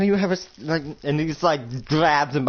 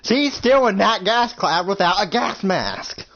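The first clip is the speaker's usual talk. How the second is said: urgent plea; 6 semitones higher than usual. Retold or imitated. Urgent justification.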